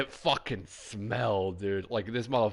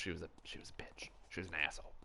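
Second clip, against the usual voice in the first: unvoiced whisper, hushed like a secret; whispered insult; 6 semitones lower, 16 dB quieter, faster than usual. Real, not acted. Streamer whispering an insult about an old teacher. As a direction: whisper it so nobody overhears.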